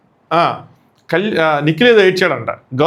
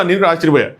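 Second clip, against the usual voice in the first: normal talking voice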